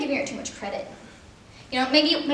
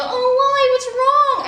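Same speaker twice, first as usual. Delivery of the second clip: high, loud fake concern; mock concern; 10 semitones higher, 9 dB louder, much slower than usual. Imitating people asking what's wrong, mock alarm. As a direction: squeal the worried question.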